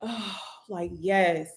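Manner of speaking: imitated long sigh, very soft; deep sigh of relief. Imagining hearing she's no competition, relieved sigh. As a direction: sigh it out, as if finally letting go. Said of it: "a deep sigh".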